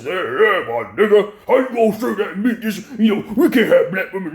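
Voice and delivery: high, loud laughing mock plea; mock addressing Taz. Man calling out Taz over his double standard, mocking and laughing. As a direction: ham it up, laughing, as if asking Taz directly. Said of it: "my question to the, to Taz exclusive".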